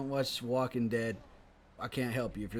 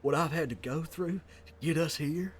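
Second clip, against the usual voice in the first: Rick Grimes impression. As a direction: brood like a weary sheriff. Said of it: "my best Rick Grimes impression".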